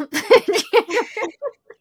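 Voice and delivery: a real high burst of laughter; bright amused laugh. Real, not acted. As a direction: laugh at your own joke. Laugh after joke.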